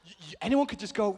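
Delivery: ordinary delivery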